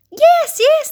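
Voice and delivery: high, loud eager agreement; chorused. A narrator's reading. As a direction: cheer it out like a keen crowd. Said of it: "they chorused".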